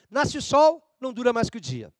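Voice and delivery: declaimed, high opening line; poem recitation. Shown as a demonstration. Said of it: "Esse é um poema do Gregório de Matos". (recitação dramática de poema)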